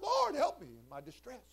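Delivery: high-pitched plea to the Lord; acted cry for help. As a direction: call out to God for help. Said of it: "I called out".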